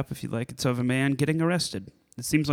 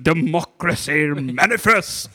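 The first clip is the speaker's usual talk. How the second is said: slow, theatrical British orator; imitated well-spoken arrestee; 4 semitones higher, 5 dB louder, slower than usual. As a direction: declaim it grandly, drawing out each word. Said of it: "he's so well spoken".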